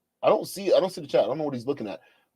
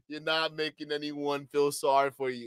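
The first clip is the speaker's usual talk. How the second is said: usual speaking voice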